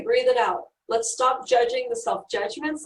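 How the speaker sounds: ordinary delivery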